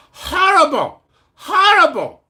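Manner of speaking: high, loud mock North Korean accent. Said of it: "That's a North Korean accent".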